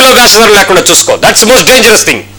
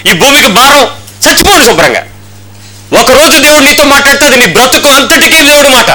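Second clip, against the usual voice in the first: raised, driving pulpit rebuke; forceful preaching; 5 semitones higher than usual. Acted. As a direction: hammer the accusation home to the church.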